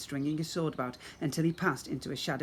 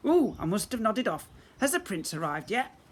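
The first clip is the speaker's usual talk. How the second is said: dozy monster voice; 6 semitones higher, 3 dB louder than usual. Acted. Sleepy monster wife.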